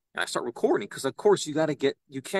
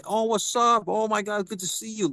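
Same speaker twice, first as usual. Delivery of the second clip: higher-pitched imitated greeting; mock excited hello; friendly welcome; 6 semitones higher than usual. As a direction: greet a familiar face eagerly. Faculty welcoming him, retold.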